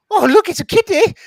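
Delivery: high, loud mock squeal of delight; hammed-up gushing over a cat; imitating an excited friend. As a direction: squeal it like an overexcited cat lover. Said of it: "Scott was gonna go".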